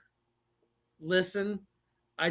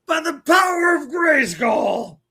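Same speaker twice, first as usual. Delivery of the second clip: high, loud hammed-up cartoon battle cry; mock battle cry; 9 semitones higher, 10 dB louder than usual. Co-host chiming in with the catchphrase, hammed-up battle cry. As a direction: yell it like raising a magic sword.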